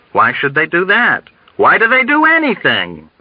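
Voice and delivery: high, heated questions; exasperated, escalating outcry; agitated argument. A narrator's reading. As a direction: fling the questions back and forth. Agitated argument between two patients.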